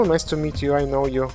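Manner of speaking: normal talking voice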